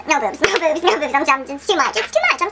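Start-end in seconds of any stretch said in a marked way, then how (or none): 2.0-2.4 silly, very high repeat; sudden leap into a squeaky voice; high squeal. Real, not acted. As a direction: squeak it out in mock overwhelm. Same words again, jumping high.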